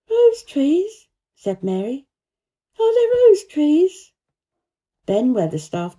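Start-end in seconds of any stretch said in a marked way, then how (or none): 0.1-4.1 much higher, louder, slow wondering question; breathless child interest; eager Mary. A narrator's reading. Mary asking about roses, eager and high. Mary's voice.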